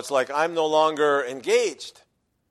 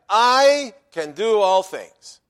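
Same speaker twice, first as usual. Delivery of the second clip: "I" said slowly and louder, leaning hard on it; stress on I; 4 semitones higher, 6 dB louder, much slower than usual. Shown as a demonstration. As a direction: land firmly on "I" in "I can do all things". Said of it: "I" is given a place to be an emphasis".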